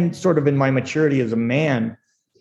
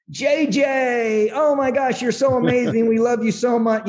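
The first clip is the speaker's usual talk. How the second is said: mock gushing in a much higher put-on voice; fawning gushing; 9 semitones higher than usual. Staff fawning over their boss, high mock gush. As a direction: ham up the fake adoration. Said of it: "it was".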